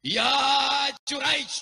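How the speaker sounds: high call again to her son; mother calls her son a second time. Acted. (ibu memanggil lagi dengan suara tinggi)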